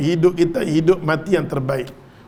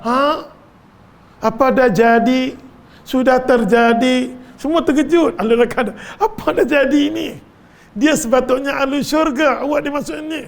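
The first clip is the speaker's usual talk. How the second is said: shocked exclamation; 7 semitones higher than usual. Acted. Hell dwellers crying out in shock at the fall, astonished. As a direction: gasp it out like stunned onlookers.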